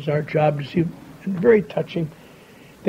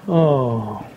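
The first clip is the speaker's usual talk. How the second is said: low, drawn-out collective groan of letdown; mock crowd moan of disappointment; crowd's disappointed groan; 5 semitones lower than usual.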